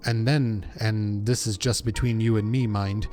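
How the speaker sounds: earnest, loosely Welsh dialect voice; amateur Welsh accent, defending the lad; attempted Welsh accent. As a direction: vouch for a young comrade in a rough Welsh accent. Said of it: "trying to do a Welsh accent".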